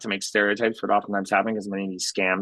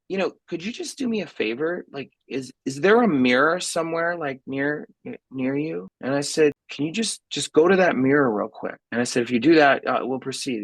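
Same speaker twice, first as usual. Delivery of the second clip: sly set-up; 6 semitones higher than usual. Retold or imitated. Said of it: "I say".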